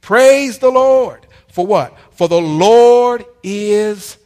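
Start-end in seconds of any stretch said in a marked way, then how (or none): 0.0-1.2 slow, high, very loud cry of praise; shouted praise. Acted. Preacher leading the church in praise, slow high shout. 2.1-4.2 slow, louder, stretched Lord then dropped good; drawn-out proclamation. Acted. Preacher proclaiming the psalm to the church, stretched loud.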